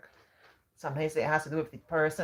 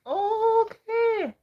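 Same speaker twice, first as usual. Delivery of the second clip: a squeaky, much higher "okay" of surprise; surprised okay; 7 dB louder than usual. Real, not acted. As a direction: yelp it out, caught off guard. Reacting to a viewer's comment.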